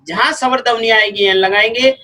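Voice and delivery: usual speaking voice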